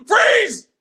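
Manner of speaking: loud, high police command; cop yelling freeze. Retold or imitated. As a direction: cops shout at Roth as he runs out, loud yell. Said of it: "And then the cops go".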